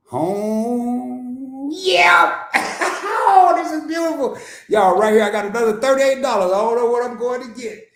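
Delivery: high, loud joyful laughter; real delight. Real, not acted. Showing viewers his account gain, high and laughing with joy. Sees his crypto balance rise.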